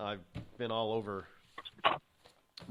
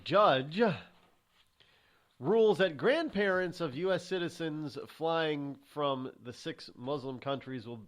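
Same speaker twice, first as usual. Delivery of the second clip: slow, emphatic headline reading; news headline read; 5 semitones higher, 3 dB louder, slower than usual. Real, not acted. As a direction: read the headline out deliberately, in big phrases. Reads out a headline.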